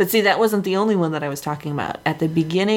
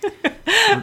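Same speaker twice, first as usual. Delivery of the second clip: high held-back laugh; stifled giggles. Real, not acted. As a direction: giggle you can't hold in. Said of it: "my wife who's trying not to laugh".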